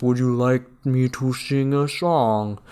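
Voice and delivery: slow, polite machine voice; slow computer offer. Retold or imitated. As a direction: ask it slowly like a gentle robot. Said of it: "And then he's like".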